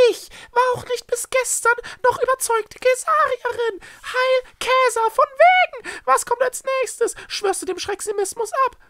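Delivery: very high, loud squawk of protest; outraged Echo. Acted. Echo mocks the Schreckse's conversion, outraged. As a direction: berate her in a high, loud voice.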